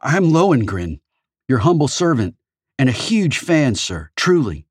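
put-on gushing, starstruck young woman; nervous young fan. Young fan character voiced.

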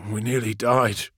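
breathy, stunned repetition; breathy repeat. A narrator's reading. Friedrich repeating himself amid the battle, breathy and dazed. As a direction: repeat it half to yourself, breathily. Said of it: "Friedrich repeated".

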